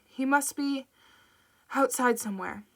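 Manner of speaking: breaking off to catch breath between words; gasping it out in short bursts. Acted. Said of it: "She had to stop every few words to catch her breath".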